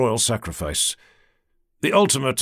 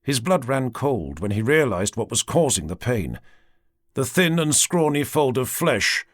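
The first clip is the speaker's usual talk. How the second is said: ordinary delivery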